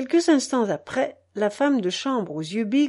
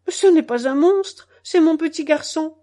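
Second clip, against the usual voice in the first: put-on higher, louder child's protest; protesting little girl; 6 semitones higher, 6 dB louder than usual. A narrator's reading. Little girl defending her boy to her mother, protesting. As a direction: object indignantly as a small child.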